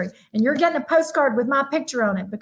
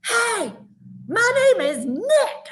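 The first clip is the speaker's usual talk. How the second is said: put-on high, slow child's voice calling out; a small boy announcing himself loudly and slowly; little boy calling; 10 semitones higher, much slower than usual. Young son greeting the cashier, high and slow. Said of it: "And so Nick got louder".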